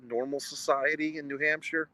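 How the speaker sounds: usual speaking voice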